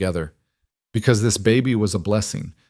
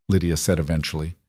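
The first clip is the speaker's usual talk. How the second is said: ordinary delivery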